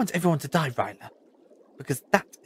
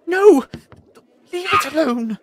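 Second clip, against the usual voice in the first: loud, high, urgent cry; desperate shout; 12 semitones higher, 13 dB louder than usual. Acted. Protesting the creature being caged, desperate shout. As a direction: shout to stop him from taking it.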